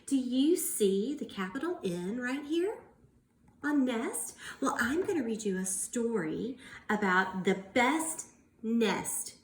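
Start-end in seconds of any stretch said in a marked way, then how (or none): none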